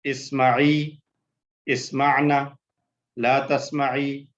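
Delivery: spaced chant, each group starting high; pause-and-repeat recitation; conjugation drill. Shown as a demonstration. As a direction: recite slowly for the class to repeat.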